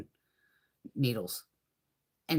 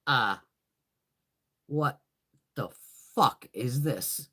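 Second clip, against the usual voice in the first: slow, drawn-out dismay; mock stunned slow-motion disbelief; 5 semitones lower, slower than usual. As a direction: drag each word out in shock. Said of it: "I'm just like".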